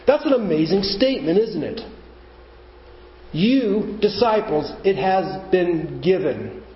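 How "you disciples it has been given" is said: "You disciples" said slowly, YOU stressed. Shown as a demonstration. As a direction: draw out YOU, then ease into "it has been given". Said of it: "you should note this word "you" is emphatic".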